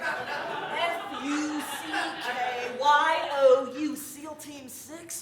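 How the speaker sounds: slow spelled-out jeering shout; mock the SEALs loudly; taunting yell. Spelling out insult, laughing.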